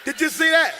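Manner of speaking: laughing shout to the crowd, pitch way up; excited shout. Real, not acted. Preacher to congregation, laughing excited shout.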